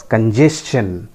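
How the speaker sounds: congestion slowly as kun-JES-chun; careful S-CH in congestion; correct pronunciation. Shown as a demonstration. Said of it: "the correct pronunciation is". (congestion का सही उच्चारण, धीरे से)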